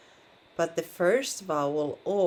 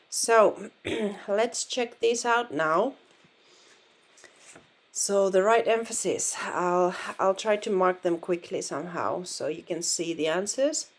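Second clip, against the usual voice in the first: ordinary talk, nothing marked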